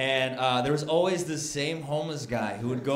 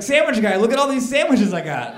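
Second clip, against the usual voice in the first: loud, higher pitched appeal; eager calling out; 6 semitones higher, 7 dB louder than usual. Acted. Rider offers sandwiches to sandwich guy, loud and eager. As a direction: call out to show them off.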